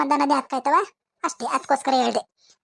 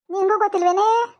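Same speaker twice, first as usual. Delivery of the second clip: ordinary talk, nothing marked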